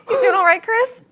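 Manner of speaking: laughing. Real, not acted. Host still amused, checking on guest.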